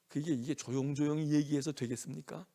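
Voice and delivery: usual speaking voice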